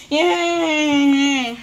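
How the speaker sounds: long drawn-out cheer; pretend cheer. Class cheering at news of field day, excited. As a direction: cheer it out as one stretched note.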